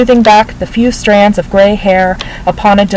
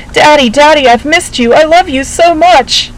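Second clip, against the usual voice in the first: higher, tearful gushing daughter; 7 semitones higher than usual. A narrator's reading. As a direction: pour it out, high and overjoyed. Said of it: "my gushing".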